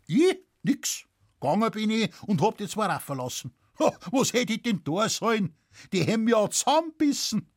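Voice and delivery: high, startled rustic protest; incredulous farmer, quick and animated; Bavarian peasant witness, defensive. Acted. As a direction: protest innocence in broad dialect. Witness excuses himself.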